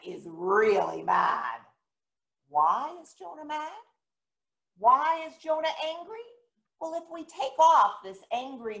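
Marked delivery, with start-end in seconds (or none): none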